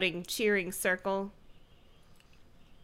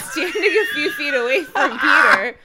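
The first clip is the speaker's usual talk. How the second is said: laughing; 5 semitones higher, 11 dB louder than usual. Acted. Reads with laughing put-on voice.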